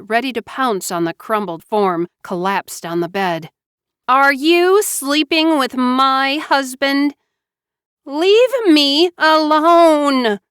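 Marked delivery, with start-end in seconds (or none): 4.1-7.2 high, loud, slow accusing demand; furious accusation. A narrator's reading. Slow loud furious accusation. Wife confronts sister. 8.1-10.4 loud high drawn-out scream. A narrator's reading. Slow loud high scream. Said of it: "Joanna screamed".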